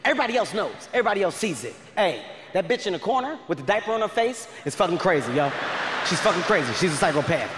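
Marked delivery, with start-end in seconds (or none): none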